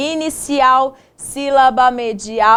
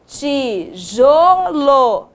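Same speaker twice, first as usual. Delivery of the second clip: slow right try; much slower than usual. Shown as a demonstration. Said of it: "Isso, muito bem".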